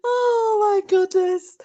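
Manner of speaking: high, slow, breathless from laughing. Real, not acted. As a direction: gasp it out between laughs. Still recovering from laughter.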